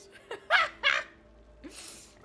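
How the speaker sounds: long, high burst of laughter; loud cackling laugh. Real, not acted. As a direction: crack up completely. Still laughing at captive.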